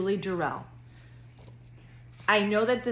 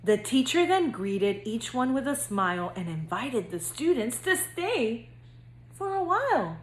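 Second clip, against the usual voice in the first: lively singsong storybook reading; reading to children; 3 semitones higher than usual. A narrator's reading. Counselor reading to future kindergartners, bouncy storybook narration. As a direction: read it like bedtime story time for little kids. Reads picture book to kindergartners.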